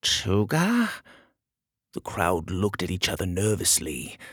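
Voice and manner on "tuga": higher, rising at the end; taken aback, questioning; startled question. Acted. Startled query about feared enemy.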